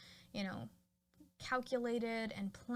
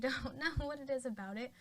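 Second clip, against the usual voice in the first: ordinary delivery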